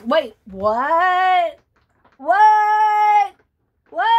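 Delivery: loud, rising what; startled double take; surprised. Real, not acted. Reacting to the twist.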